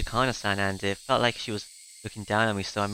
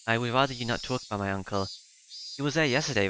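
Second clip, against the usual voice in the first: ordinary delivery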